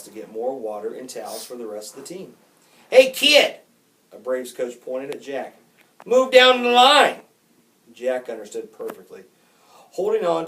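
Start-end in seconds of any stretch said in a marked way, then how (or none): 2.9-5.6 loud, high call across the field; coach's shout. A narrator's reading. A coach hollers at the bat boy, shouting. 6.1-7.2 loud, high, slow holler; coach's shout. A narrator's reading. A Braves coach orders Jack down the line, shouting.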